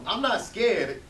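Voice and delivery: defensive. Acted. Defensive denial to Tracy.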